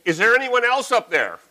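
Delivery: high, loud shout upward; shouted again. A narrator's reading. Mountaineer shouting for anyone else up there. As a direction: call out, hunting for another answer. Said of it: "the voice shouted again".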